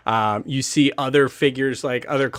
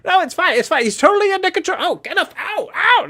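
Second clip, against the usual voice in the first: much higher, louder halfling voice; panicky halfling; 4 dB louder, faster than usual. Acted. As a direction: squeak it out in panic. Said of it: "poor little halfling Arwell goes".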